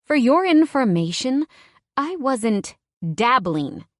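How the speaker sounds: put-on offended woman snapping back at her boyfriend; angry retort. A narrator's reading.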